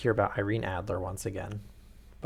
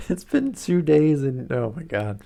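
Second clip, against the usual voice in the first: incredulous; 6 semitones higher, 6 dB louder than usual. Real, not acted. Reader reacting to Watson's sudden engagement, high and incredulous. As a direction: drag it out, can't believe it.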